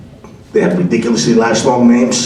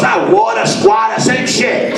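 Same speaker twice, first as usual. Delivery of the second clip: high, dismissive Italian landlord; old-school Italian accent; 10 semitones higher, slower than usual. Retold or imitated. Landlord shrugs off the tenant's correction, old-school Italian accent. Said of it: "It's old school Italian".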